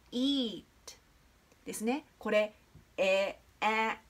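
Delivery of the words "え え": え、え as a split eh-eh, the way eat is not said; mock mistake, eh-eh instead of a long ee; wrong pronunciation demo. Shown as a demonstration. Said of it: "というふうには、え、言いません".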